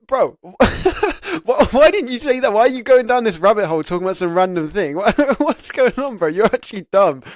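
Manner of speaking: high, loud, laughing self-mockery; cracking up at his own blunder; laughing at himself. Speaker mocks his own interview answer, laughing loudly.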